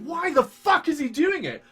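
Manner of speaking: high, loud disbelieving yell; baffled, half-shouted question; exasperated outburst. Retold or imitated. Retelling how baffled people felt, loud yell of disbelief. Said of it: "You're like".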